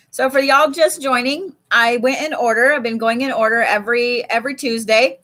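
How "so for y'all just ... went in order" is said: ordinary talk, nothing marked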